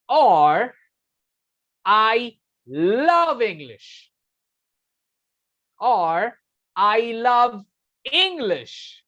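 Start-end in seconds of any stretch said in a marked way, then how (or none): 1.8-3.9 "love" stretched slowly and pushed up high; stress on LOVE. Shown as a demonstration. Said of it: "they stress on particular word". 6.7-9.0 "English" punched high with a sharp drop; stress on ENGLISH. Shown as a demonstration. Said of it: "they stress on particular word".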